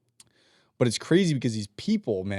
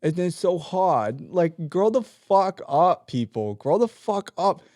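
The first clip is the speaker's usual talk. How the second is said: put-on high whiny baby voice, louder on the complaints; baby accent; 6 semitones higher, 3 dB louder, slower than usual. Mocking lazy coworkers who complain about pay, high whiny baby voice. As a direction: whine like a toddler who won't grow up. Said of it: "it's my baby accent".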